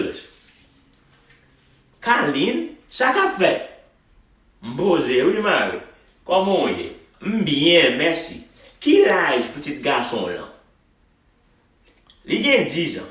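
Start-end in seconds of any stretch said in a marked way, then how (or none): 2.0-13.1 lively Haitian Creole conversation read at native speed; real Haitian accent. Shown as a demonstration. Teacher performs the dialogue for students in a real Haitian accent. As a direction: read it the way you'd talk to a Haitian. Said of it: "I'm gonna read it with some real Haitian accent for you".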